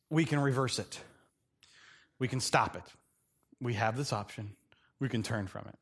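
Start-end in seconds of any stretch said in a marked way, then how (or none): none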